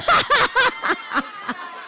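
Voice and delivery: high burst of laughter at her own joke; big amused laugh. Real, not acted.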